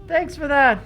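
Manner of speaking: tiny high far-off voice; a small creature calling from a distance; high little sprite voice. Acted. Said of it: "It sounds, like, far off".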